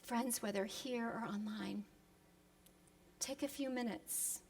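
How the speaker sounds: soft, slowing prayer voice; hushed invitation to close eyes; quiet prayer lead-in. Acted. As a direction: guide them gently into prayer. Leads into prayer.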